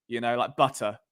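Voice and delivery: ordinary talk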